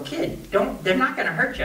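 high-pitched acted coaxing; retold exasperated reassurance. Cowhand to the scared kid, exasperated reassurance. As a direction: pitch up, telling a scared kid it's fine.

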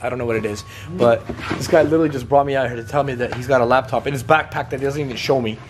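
normal talking voice

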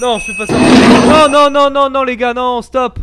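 loud panicked pleading; frantic shouted no no; panicking gamer. Real, not acted. Pleading during scare.

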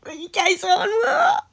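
rising high, laughing disbelief; mock outrage at the absurd theory; incredulous. Recalling her reaction to the theory with high laughing disbelief. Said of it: "I thought".

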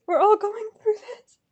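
high voice climbing and thinning out; distressed squeak. Real, not acted. Fan commiserating with others waiting, distressed squeak. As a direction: let it rise into a small whine. Consoling fellow waiting fans.

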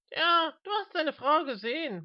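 high, swooping teasing voice; mocking companion. A narrator's reading. Mister Smith teasing the old man, high voice.

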